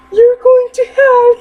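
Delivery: high, loud parrot squawk. Acted. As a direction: screech the curse like a parrot. Parrot squawks its old curse.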